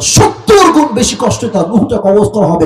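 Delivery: ordinary delivery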